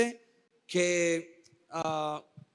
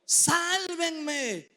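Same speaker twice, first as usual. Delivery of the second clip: higher, slow drowning man's cry; desperate cry for help; 5 semitones higher, much slower than usual. Shown as a demonstration. Preacher acting a drowning person, desperate cry. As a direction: cry out like someone going under. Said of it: "grita con desesperación".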